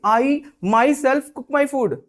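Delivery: myself hit hard and high, slow; with emphasis. Shown as a demonstration. Stressing myself so the doubting listener finally understands. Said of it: "ये हमने थोड़ा सा जोर दे के बोला".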